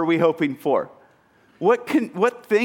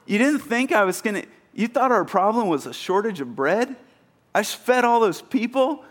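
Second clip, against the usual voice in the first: incredulous, high-pitched disbelief; mock disbelief at their lack of faith; exasperated Jesus; 5 semitones higher than usual. Preacher voicing Jesus scolding disciples, incredulous. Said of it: "he'd go".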